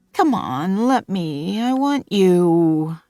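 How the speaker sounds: high, pleading whine, drawn out at the end; slow pleading whine; whining. A narrator's reading. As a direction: beg like a desperate child. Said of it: "I whine".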